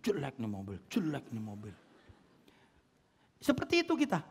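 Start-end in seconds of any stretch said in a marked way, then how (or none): 0.1-1.7 low, soft muttered disgust; disgusted grumble. Retold or imitated. Car owner two years on, low soft disgusted grumble. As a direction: sneer at the old car under the breath.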